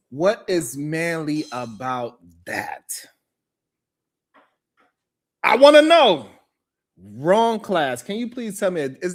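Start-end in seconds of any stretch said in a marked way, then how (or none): 0.1-3.0 slow, unvoiced pointed whisper. Real, not acted. Host whispering his mocking question to the co-host. 5.4-6.3 sudden loud demand, pitched up. Real, not acted. Host demanding an answer from the co-host, loud.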